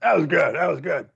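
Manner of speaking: lightly voiced big-shot agent praising the kid; the manager Bernie praising the young comic, lightly voiced; mock old manager voice.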